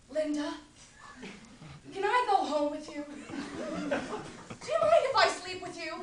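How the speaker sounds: mocking Barry. Linda mimics Barry asking to sleep with her, mocking. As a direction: send up his pitiful come-on, half-laughing.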